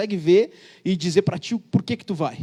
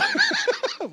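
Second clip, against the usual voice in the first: a shrill, very high burst of laughter. Real, not acted. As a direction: squeal with laughter. Laugh after lawyer joke.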